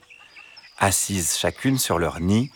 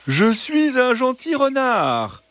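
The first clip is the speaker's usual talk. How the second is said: high, slow, coaxing fox voice; gentle, drawn-out high fox; soft reassuring fox; slower than usual. A narrator's reading. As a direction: draw it out sweetly to win their trust. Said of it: "Une voix douce et rassurante".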